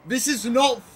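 loud, high cries of disbelief; distraught shouting. Real, not acted. Player watching the run die, distraught shouting. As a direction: yell each burst in real despair.

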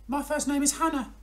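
very high little hedgehog voice; a tiny squeaky hedgehog. Acted. Hedgehog tells frog its name, very high.

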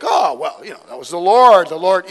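hammed-up fib; bluffing excuse. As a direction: cover up with an emphatic excuse. Son bluffing an excuse to father.

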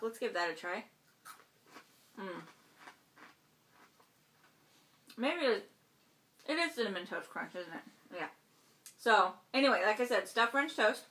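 muffled words between bites; talking while chewing. Real, not acted. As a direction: mouth partly full of food. Seen 0: Lips closed, jaw working on bite taken just before; hand near mouth.

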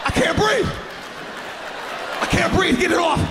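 high choking mock panic; high smothered kid panicking under a towel. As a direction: gasp it out like you're smothering. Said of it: "I'm like".

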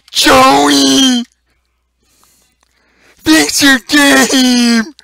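loud, high yell, the last words slow; yelling at dev. Real, not acted. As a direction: call the developer out across the room.

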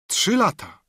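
high exclaimed cry; zawołanie. Acted. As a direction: burst out in surprise. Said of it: "zawołałem". (okrzyk zdziwienia)

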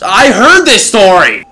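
high, drawn-out shout; exasperated yell. Real, not acted. Gamer who knows the story already, loud high yell. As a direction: yell it over the game. Yelling back at game dialogue.

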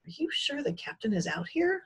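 high, small mouse voice. A narrator's reading. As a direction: squeak it like a nervous mouse. Sprocket asks nervously.

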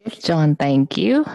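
usual speaking voice

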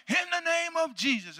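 high, stretched prayer call; re-enacted commanding prayer. Preacher praying for the sick in Africa, commanding prayer. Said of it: "so I'm praying".

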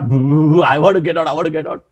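normal talking voice

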